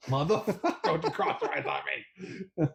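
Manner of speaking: indignant but cracking up; laughing mock outrage. Real, not acted. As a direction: protest through helpless laughter. Mock outrage over crossed-fingers joke.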